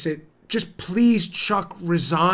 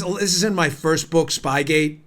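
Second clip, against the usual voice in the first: usual speaking voice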